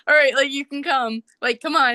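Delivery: lightly acted "come along then" urging; retold urging. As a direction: wave someone along, imitating their go-ahead. Retells a grudging last-minute invitation.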